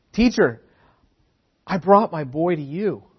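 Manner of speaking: high, loud cry of a desperate father; pleading father. Acted. Father begging Jesus to heal his boy, loud desperate plea. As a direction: beg the teacher for help.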